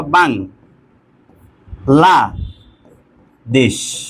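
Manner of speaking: "বাং, লা, দেশ" as three separate beats; long pauses after "বাং" and "লা"; split into syllables. Shown as a demonstration. Teacher showing what one breath can say, "বাং, লা, দেশ" syllable by syllable. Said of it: "প্রথমে উচ্চারণ করতে পারবা".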